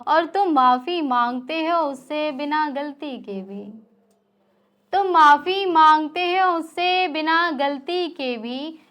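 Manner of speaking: poem recitation. Acted. Poet reciting angry verse to a live audience, impassioned recitation. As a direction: recite the shayari, building on the repeat.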